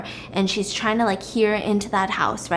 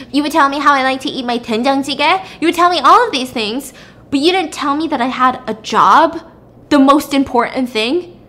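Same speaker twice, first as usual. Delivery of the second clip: retold angry accusation; 5 semitones higher, 7 dB louder than usual. Wife confronting husband over secrets.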